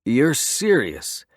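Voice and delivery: slow, doubting question; a disbelieving biker, drawn out; skeptical. A narrator's reading. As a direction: ask it like you can't quite believe him. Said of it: "staring at me skeptically".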